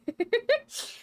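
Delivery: giggling at her own mistake; a helpless burst of laughter; embarrassed laugh. Real, not acted. Can't pronounce the word.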